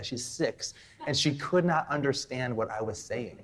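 ordinary talk, nothing marked

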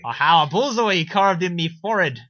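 over-eager Irish joker, rising to a shout; hammed-up Irish villain. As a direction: nudge harder with each line in cartoon Irish. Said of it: "'cause just 'cause Bullseye's like".

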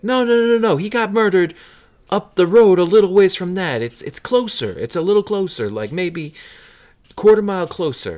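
high, loud hammed-up correction; insistent local. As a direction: play a worked-up guy setting the record straight. Other patron corrects him loudly.

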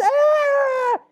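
high piercing scream of fear; terrified scream. Acted. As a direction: scream as the wave hits. Storm at sea, terror.

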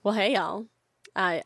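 ordinary talk

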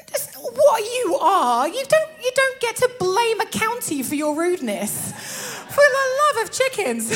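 high-pitched, exasperated telling-off; playful, high indignant rebuke; mock scolding. Mock scolding of a rude northerner.

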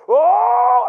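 very loud, high drawn-out holler; re-enacted full-throated yell; imitated yell. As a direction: yell it long and wild. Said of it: "I'm yelling on the car".

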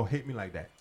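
usual speaking voice

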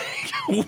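sudden high laughing shriek. Real, not acted. Laughing at retold therapist moment.

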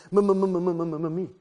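rapid stammer on the M before "me"; eager stutterer fighting to say "me"; stuttering. Acted. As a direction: stutter "m-m-m" until "me" comes out. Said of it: "Stutter Smurf is not put off".

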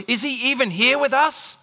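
incredulous, high-pitched challenge; doubting. Acted. Israelites doubting God's presence, incredulous and challenging. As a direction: question it in high-pitched disbelief. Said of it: "they're doubting his presence".

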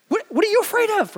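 very high, quick mock disbelief; incredulous. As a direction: squeak it out, as if baffled by the fear. Mocking fear before the impossible.

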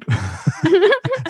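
put-on high wheezy old-timer laugh; old prospector cackle. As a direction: cackle like a crusty gold miner. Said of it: "That's what he sounds like, the old prospector".

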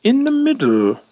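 parroting "In the middle." in an exaggerated posh English voice to tease; mock posh English. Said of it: "he turned around and we went".

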